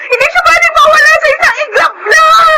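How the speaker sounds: high anguished crying; wailing denial. Acted. Mother refuses to accept baby's death, wailing. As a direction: cry out against it.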